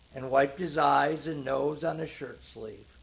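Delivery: plain reading voice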